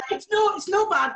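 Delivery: high mock Scottish "no bad", rolled and broad; a playful broad Scottish take on "It's no, it's no bad"; Scottish accent put on for a laugh. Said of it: "do your best Scottish accent".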